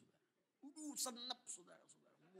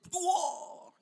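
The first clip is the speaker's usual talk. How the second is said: loud, high slamming bang noise; imitated door slam; 19 dB louder than usual. Elder slams the bedroom door, loud imitated bang.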